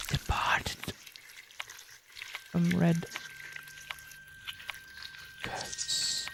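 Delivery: soft, halting whisper of a doll; creepy doll whisper. Acted. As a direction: whisper it, fading, as a dying doll. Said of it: "What did the female doll say?".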